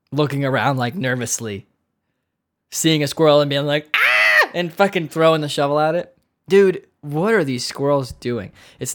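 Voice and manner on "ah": very high, loud shriek; mock panicked scream. Nervous homeowner seeing a squirrel, mock panicked scream. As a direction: shriek as if a squirrel just appeared.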